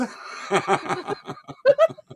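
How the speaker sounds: high laugh. Real, not acted. Laughing at own impression.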